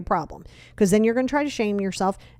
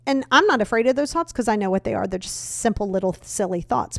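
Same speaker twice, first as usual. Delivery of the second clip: ordinary delivery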